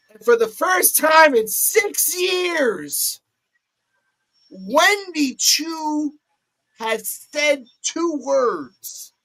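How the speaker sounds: high, very slow dramatic call; announcer drama. Acted. Announcing Wendy's first words, dramatic and slow. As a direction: stretch it out like a big moment.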